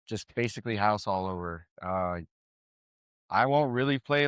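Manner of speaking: usual speaking voice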